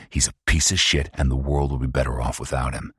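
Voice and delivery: ordinary delivery, nothing marked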